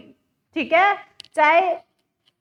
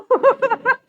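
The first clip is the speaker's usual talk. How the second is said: sudden high loud burst of mock laughter; imitating the women's laugh; 7 semitones higher, 6 dB louder than usual. Teacher mimics the Bishnoi women laughing at the havan, high mock laugh. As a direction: ape their loud laugh, higher than her own. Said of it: "थोड़ी देर बाद हंस देती है".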